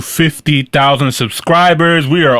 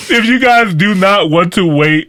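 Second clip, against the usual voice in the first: laughing through words; 6 semitones higher than usual. Real, not acted. Host cracks up mid-sentence.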